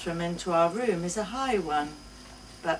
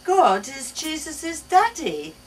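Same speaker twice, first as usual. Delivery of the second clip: put-on high, louder child's voice; confident little girl; 8 semitones higher, 8 dB louder than usual. A narrator's reading. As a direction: explain it proudly like a small girl. Child answering mother.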